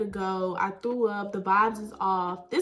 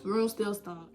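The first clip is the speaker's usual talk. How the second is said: ordinary talk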